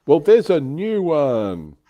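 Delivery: slow, loud, playful reveal; mock-dramatic announcement. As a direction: make a big show of announcing it. Teasing announcement of new Sherman kit.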